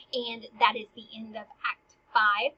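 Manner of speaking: ordinary delivery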